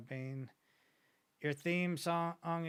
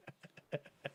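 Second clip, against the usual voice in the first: breathless, airless wheezing laugh; wheezy laugh; 10 dB quieter than usual. Real, not acted. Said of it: "you sounded like a dying man with how you laughed".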